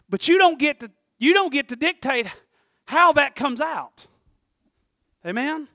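loud, high preacher's cadence; full-force preaching. Acted. Pastor preaching hard to his church about sowing. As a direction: drive the point home to the pews.

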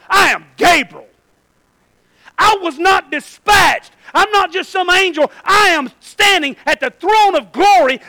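high, slow, commanding shout; thundering angel's rebuke. Acted. Angel answering the old man's excuse, thundering rebuke. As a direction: proclaim it at full force, drawing out the words.

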